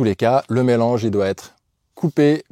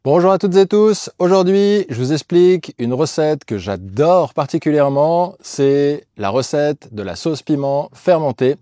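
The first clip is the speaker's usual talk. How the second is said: bright, higher, louder video host; cheerful presenter intro; 4 semitones higher, 5 dB louder than usual. Acted. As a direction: greet viewers with energy.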